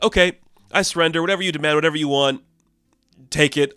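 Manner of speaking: panicked surrender. Retold or imitated. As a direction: give up in a rushed panic. President panics as door breaks.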